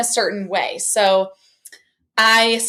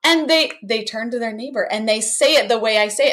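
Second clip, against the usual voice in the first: normal talking voice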